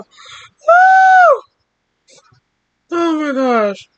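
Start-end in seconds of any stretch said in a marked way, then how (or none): 0.0-1.4 high, loud whoop of laughter; excited whoop. Real, not acted. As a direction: whoop it out, overcome. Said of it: "I need to calm down".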